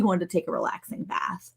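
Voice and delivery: an embarrassed mom's half-whispered excuse; imitating a mom's sheepish whispered explanation. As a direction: whisper it apologetically. Embarrassed mom explaining her son.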